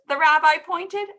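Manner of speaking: usual speaking voice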